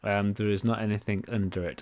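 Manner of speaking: ordinary talk, nothing marked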